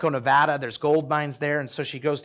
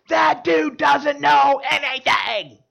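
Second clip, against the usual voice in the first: high, slow, shouted scorn; imitated scream; 9 semitones higher, 5 dB louder, much slower than usual. Angry student screaming at the preacher across the dining hall. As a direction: scream it across the room, dragging each word. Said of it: "she's just screaming in the dining hall".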